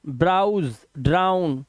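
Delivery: ordinary delivery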